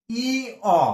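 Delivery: ই, অ as long nasal "ii", then open "o"; letter-name demonstration. Shown as a demonstration. Teacher asking students this letter's pronunciation, answering drawn-out and split. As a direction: hold ই, pause, then say অ. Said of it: "এর উচ্চারণটা কি বলতো".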